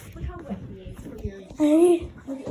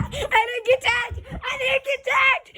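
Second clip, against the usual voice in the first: triumphant shout; 6 semitones higher than usual. Real, not acted.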